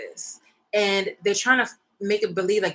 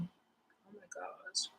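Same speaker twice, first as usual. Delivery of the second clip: slow, breathy disgusted whisper; 20 dB quieter, much slower than usual. Real, not acted. Disgusted at cat hair.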